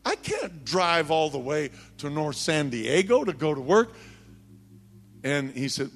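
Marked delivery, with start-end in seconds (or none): 0.0-3.9 high-pitched, imitated complaint; indignant protest. Sister objecting to her boss's transfer order, indignant. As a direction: argue back, pitched up.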